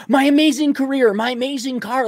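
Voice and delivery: mock gushing. Mocking women gushing over everything.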